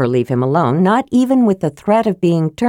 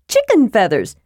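higher, eager kender voice on "Chicken feathers"; excited Tass; 5 semitones higher than usual. Acted.

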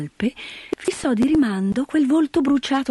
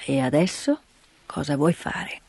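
put-on lower, slow gruff old man; 7 semitones lower, slower than usual. Grandfather asks Heidi, low gruff voice.

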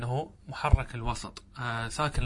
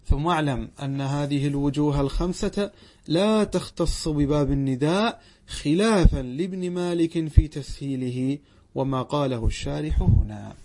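slow, louder formal recitation of the footnote; footnote reading; 5 dB louder, slower than usual. Acted. Teacher reciting the footnote to students, slow and loud. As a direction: read the commentary out to the class, deliberate.

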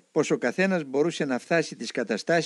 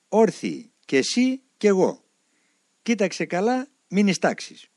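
firm order; 8 semitones higher, 4 dB louder than usual. Retold or imitated. Elder giving firm orders.

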